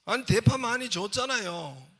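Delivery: high, loud acted complaint; exasperated protest. Restaurant owner answering the insistent customer, exasperated protest. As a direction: protest loudly, pitched well up.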